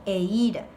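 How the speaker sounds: "eira" with the I accented; teacher's model "eira", weight on the I; stress on the I. Shown as a demonstration. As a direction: lean on the middle "i" of "eira". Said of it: "con acento en la I".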